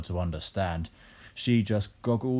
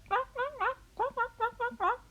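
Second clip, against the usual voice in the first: very high squawked clucks; hen noise. A narrator's reading. Big hen sitting in the yard, high squawking. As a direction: squawk it in short bursts like a hen. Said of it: "the big brown hen sat down ... and went".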